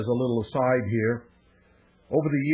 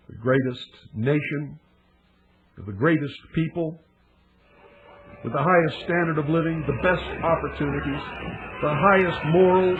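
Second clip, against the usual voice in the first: dramatic oration; 2 semitones higher than usual. Real, not acted. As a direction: declaim it in measured chunks, building then falling grave. Builds a dramatic opening oration.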